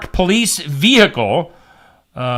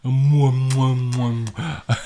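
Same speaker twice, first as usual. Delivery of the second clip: kissy French mwah sounds, fading softer; French accent; 4 semitones lower, 6 dB quieter, much slower than usual. Pretend. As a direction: blow French kisses, fading away. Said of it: "I like your French voice".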